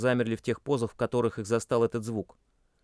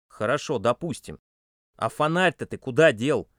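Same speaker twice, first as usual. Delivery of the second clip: irritated; 4 dB louder than usual. A narrator's reading. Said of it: "раздражённо допытывался".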